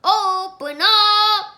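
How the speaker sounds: loud, high, slow yell; robbers yelling. Acted. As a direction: roar at the door. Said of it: "they yelled".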